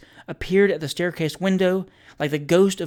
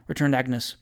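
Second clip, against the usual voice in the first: plain reading voice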